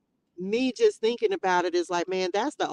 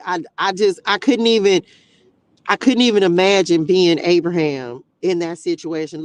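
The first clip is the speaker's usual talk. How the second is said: emotional outburst; 9 dB louder than usual. Real, not acted. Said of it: "it's making me emotional".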